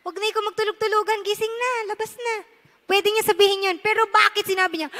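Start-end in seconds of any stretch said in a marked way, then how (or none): 0.0-2.4 much softer, quick offhand coaxing; quiet playful nudge; casual soft call. Retold or imitated. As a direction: gently tease him out of pretend sleep. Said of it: "Di ba?".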